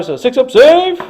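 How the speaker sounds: slow, high, loud dice call; excited callout. Real, not acted. Rolling saves for his squad, excited callout. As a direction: drag it out hoping for the save.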